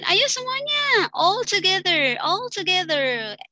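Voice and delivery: high, slow, loud class call; rally every student at once. Shown as a demonstration. Teacher calling all students to answer, high and drawn out. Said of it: "Gesturnya gimana tuh Rahma kalau all together".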